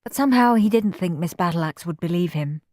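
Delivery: plain reading voice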